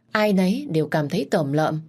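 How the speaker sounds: plain reading voice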